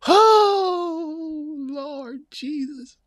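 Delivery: high, drawn-out Lord Jesus wail; a slow, high exclamation of disbelief; mock wail. Real, not acted. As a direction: cry out to heaven in comic disbelief. Overcome after laughing at comment.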